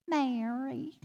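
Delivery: put-on a light Southern accent drawing out "Mary". Caregiver imitates Bea saying her name in a mild Southern accent. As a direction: say "Mary" with a mild Southern lilt, rising at the end. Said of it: "With a Southern accent".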